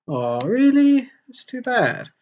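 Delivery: put-on high, drawn-out girlish voice; lightly hammed-up high-pitched letdown; disappointed girl friend. Sayori learns her friend is leaving, high disappointed girl.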